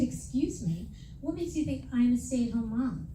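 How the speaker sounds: ordinary delivery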